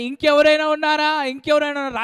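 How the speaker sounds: the man yelling into the valley for someone else to help, loud; calling out for help. Retold or imitated. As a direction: shout out for anyone else. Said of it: "రాత్రంతా అరిచాడు".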